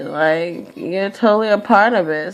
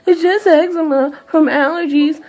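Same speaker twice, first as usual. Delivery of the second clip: higher, sing-song dismissive mock; mocking dismissal; 10 semitones higher than usual. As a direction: brush it off in a snooty parody voice.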